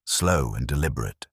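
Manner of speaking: plain reading voice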